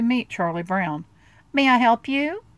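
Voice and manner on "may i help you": higher, louder bossy Lucy voice; a lightly voiced little girl ready for business; Lucy asking. Lucy greeting Charlie Brown at her booth, higher and bright. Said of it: "she asked".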